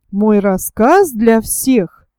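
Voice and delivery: ordinary delivery, nothing marked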